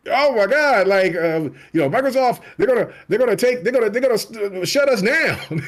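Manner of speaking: high, fast, panicky whining; hammed-up panic, voice racing and high; mock panic. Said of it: "they're screaming bloody murder".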